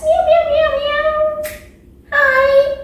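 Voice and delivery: very high squeaky meows; happy cat meows. Pretend. Teacher meows for kids as a happy cat. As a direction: meow like a happy kitten, way up high. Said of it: "Hi, happy cats".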